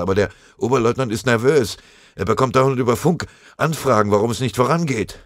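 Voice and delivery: lightly voiced runner defending himself; pleading messenger. A narrator's reading.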